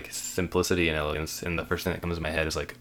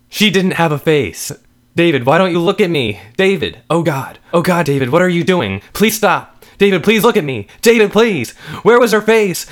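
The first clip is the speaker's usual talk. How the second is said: loud, high pleading building higher; frantic cries; 12 semitones higher, 13 dB louder than usual. Acted. Wife begs David to look at her, frantic cries. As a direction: panic rising toward a scream.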